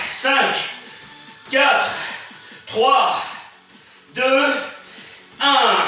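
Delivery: workout rep counting. Real, not acted. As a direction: count them down like a coach. Coach counts down the hold.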